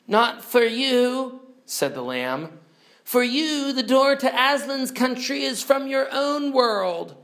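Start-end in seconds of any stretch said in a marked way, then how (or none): 0.1-1.4 high, drawn-out lamb voice; high and measured storybook lamb; lamb's voice. Acted. 3.0-7.2 high, sustained lamb voice; high storybook lamb pronouncement; lamb's voice. Acted.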